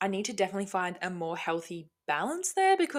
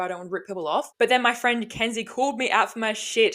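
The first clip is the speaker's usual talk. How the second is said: usual speaking voice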